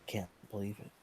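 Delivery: soft, shocked murmur; stunned disbelief. Acted. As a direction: say it numbly, barely aloud. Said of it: "in shock".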